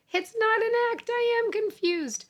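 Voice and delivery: high-pitched insistence on being confused; mock protest. Teacher playing out an example sentence, high mock protest.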